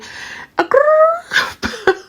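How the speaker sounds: very high, shrieking cackle; a real high-pitched giggle; squealing laugh. Real, not acted. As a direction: let out a high gleeful shriek. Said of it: "I shouldn't do that sound here".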